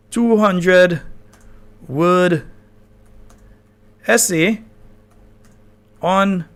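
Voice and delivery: each word spaced out with long pauses; slow dictation. Shown as a demonstration. Host dictating the prompt to ChatGPT on air, slow and deliberate. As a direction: spell out the prompt word by word as you type it. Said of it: "Let's ask it to".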